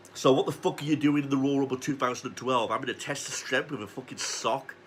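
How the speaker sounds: ordinary talk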